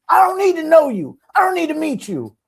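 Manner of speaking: very high, loud, upset mock shout; yelling neighbor. Neighbor refuses to meet girlfriend, high wounded yelling. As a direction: imitate him hollering his refusal. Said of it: "he wouldn't stop yelling".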